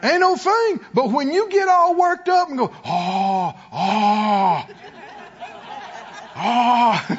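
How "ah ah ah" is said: mock outraged sputter. Mock outrage of someone worked up.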